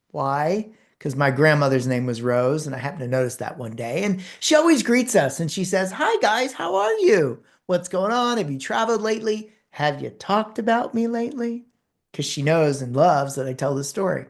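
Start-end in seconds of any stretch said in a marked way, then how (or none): 5.9-11.7 imitating a bubbly cashier, pitched high; cheery cashier greeting. Cashier Rose greeting the speaker's family, high and bubbly. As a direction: sing out a friendly hello to regulars.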